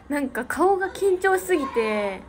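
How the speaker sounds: ordinary talk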